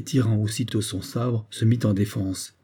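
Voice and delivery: ordinary delivery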